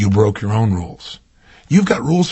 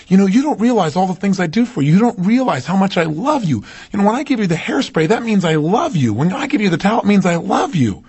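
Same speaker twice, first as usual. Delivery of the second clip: higher, insistent retelling of a wife's plea; mild mock reproach in a higher voice; imitating earnest wife; 7 semitones higher than usual. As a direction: plead to be appreciated, pitched up. Voicing wife's loving complaint.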